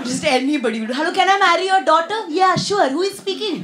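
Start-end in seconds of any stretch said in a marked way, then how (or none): 1.0-2.2 eager caller voice. Retold or imitated. Acted phone call. 2.4-3.6 eager parent voice. Retold or imitated. Parents answering phone.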